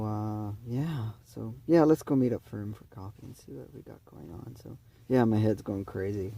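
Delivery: usual speaking voice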